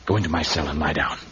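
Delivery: tief, leise, beschwörender Hypnotiseur; hypnotisierend. Acted. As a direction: leg sie mit tiefer, leiser Stimme in Trance. Putting the guard to sleep.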